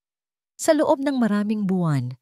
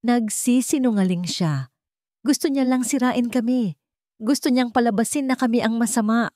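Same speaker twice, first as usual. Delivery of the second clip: desperate, cracking voice; 7 semitones higher than usual. Acted. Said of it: "basag at desperado".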